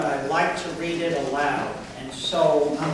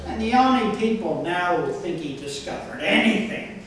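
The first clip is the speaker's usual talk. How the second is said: measured, slightly raised recital voice for a comic poem; deadpan comic poetry recital with deliberate phrasing; poem reading; 6 semitones higher than usual. Acted. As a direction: read it out line by line to an audience, punching key words.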